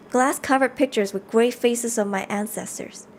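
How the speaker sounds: normal talking voice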